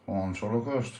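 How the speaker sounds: normal talking voice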